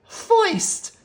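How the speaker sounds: high, loud, slow bully drawl; Too Tall bully voice. A narrator's reading. Too Tall telling the gang his plan, high gloating voice.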